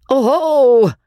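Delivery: bellowed, drawn-out jeer; teasing bellow. A narrator's reading. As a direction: bellow it out to tease him. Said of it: "bellowed the teaser".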